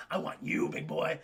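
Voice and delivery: whispered creature menace; low, voiceless threat; imitated predator. Acting dinosaur's menacing reply.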